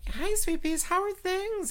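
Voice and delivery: high falsetto hello; high greeting. Pretend. Drag queen greeting listeners in a high falsetto. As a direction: coo a hello to the fans.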